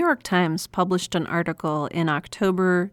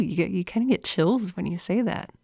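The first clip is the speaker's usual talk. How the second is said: usual speaking voice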